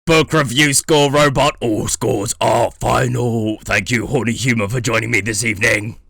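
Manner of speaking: loud robotic announcer. Acted. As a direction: stiff robot host greeting. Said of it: "You didn't even sound like a robot this time".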